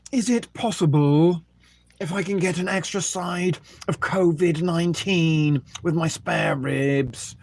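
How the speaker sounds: hammed-up customer placing an order; mock food order. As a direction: call out a takeaway order with exaggerated politeness. Acts out a takeaway order.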